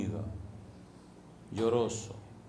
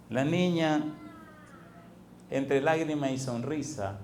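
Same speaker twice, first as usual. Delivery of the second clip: usual speaking voice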